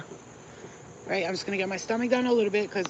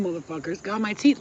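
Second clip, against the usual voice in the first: ordinary talk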